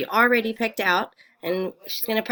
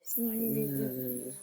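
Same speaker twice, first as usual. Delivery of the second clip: pretend snore; 10 dB quieter than usual. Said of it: "That's different. It didn't say yes".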